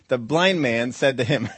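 slow, amused emphasis on "blind"; stress on blind. Shown as a demonstration. Preacher repeating the verse for effect, "blind" stressed. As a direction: punch "blind" and slow the phrase down. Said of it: "we're emphasizing the guy's blind".

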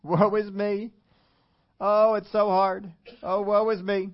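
hammed-up woe-is-me wail, high and loud; mock lament. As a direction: complain theatrically, stretching out the self-pity. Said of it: "after crying all night and saying".

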